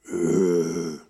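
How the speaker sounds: low, loud imitated growl; monster growl. Boy hears something under his bed, low monster growl. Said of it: "under my bed, I heard".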